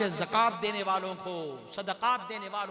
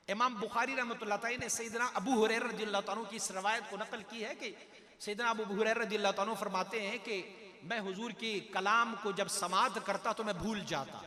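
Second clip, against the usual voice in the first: normal talking voice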